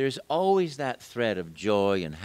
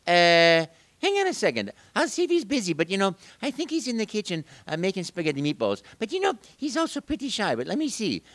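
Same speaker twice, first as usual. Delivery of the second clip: put-on much higher Italian accent; Mario; 9 semitones higher, 4 dB louder than usual. Mario tells children Luigi is busy in the kitchen, high and playful. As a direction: stall the kids playfully as Mario. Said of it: "so I say".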